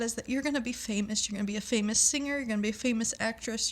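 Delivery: ordinary talk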